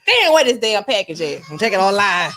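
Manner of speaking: hammed-up annoyed shopper; mock irritated rant. To listeners, mock-fuming about a package that never came. Impatient customer waiting on package.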